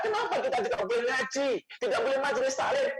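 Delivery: imitated complaint, pitched high; mock protest yell. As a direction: half-shout it like an indignant crowd. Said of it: "Kita teriak-teriak".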